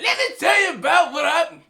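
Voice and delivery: high, shouted comedian impression; Chris Rock. Copying the comedian telling the crowd about something, Chris Rock impression. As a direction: shout it like a stand-up comic launching a bit. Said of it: "I look like him, whatever".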